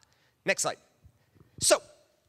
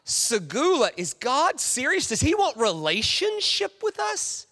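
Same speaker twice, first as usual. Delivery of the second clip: amazed disbelief; 6 semitones higher than usual. Retold or imitated. Freed slaves marveling that God wants relationship. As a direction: wonder if it's real. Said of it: "It was like".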